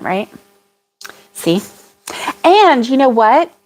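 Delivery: ordinary delivery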